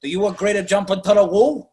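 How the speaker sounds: lightly voiced old mobster chief; hint of a Godfather mobster; Abe Vigoda. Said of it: "He sounds like Tessio from The Godfather".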